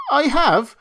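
high, loud protest; indignant cry. A narrator's reading. Toy rabbit denying the taunt, loud indignant cry. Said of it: "cried the little rabbit".

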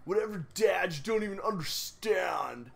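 a hint of a sulky Simpsons teen; Snake teen voice. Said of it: "my teenager voice is Snake from The Simpsons".

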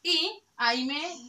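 usual speaking voice

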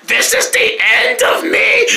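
high, slow dramatic wail; mock despair. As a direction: ham up doom. Falling down stairs.

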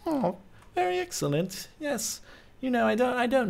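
high-pitched mocking imitation of a businessman; hammed-up high executive voice; gloating executive. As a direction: play a scheming vice president, high and pleased. Mimics the game's vice president.

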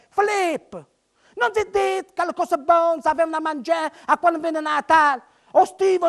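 usual speaking voice

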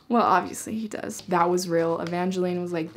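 usual speaking voice

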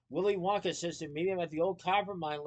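plain reading voice

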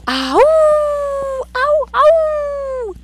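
high, loud wolf howl. Pretend. Teacher to class, demonstrating a high wolf howl. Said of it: "What does a wolf say?".

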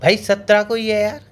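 husband insisting on the date, loud and fed up; exasperated insisting. Acted. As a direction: push the date on her.

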